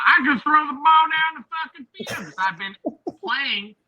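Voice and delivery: high, loud cocky jock voice; mocking Bo Nix. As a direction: brag it up like a smug quarterback. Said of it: "with Bo Nix going".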